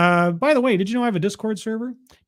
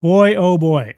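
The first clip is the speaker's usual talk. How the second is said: emphatic; 7 dB louder, slower than usual. Real, not acted. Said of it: "repeated with more force".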